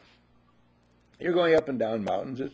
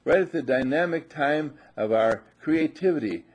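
ordinary talk, nothing marked

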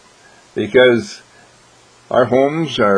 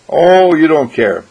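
whiny complaint; 5 semitones higher, 7 dB louder than usual. Acted. Sulking family member complains.

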